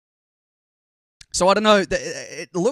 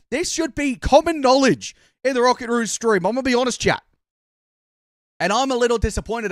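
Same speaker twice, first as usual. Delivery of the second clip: higher, louder telling-off of chat; playfully indignant, pitched up; mock scolding; 7 semitones higher, 5 dB louder than usual. Real, not acted. Streamer tells chat off over the botched chant, mock scolding.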